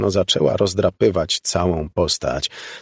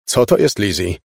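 alarmed demand; 4 dB louder than usual. A narrator's reading. Father presses Lizzie for an answer, alarmed.

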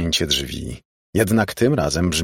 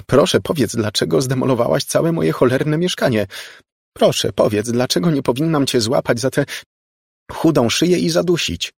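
high, raised voice building to a threat; exasperated anger; 8 semitones higher, 3 dB louder than usual. A narrator's reading. Margo turns her please into a threat, angry and high. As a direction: spit mock-polite pleas in anger.

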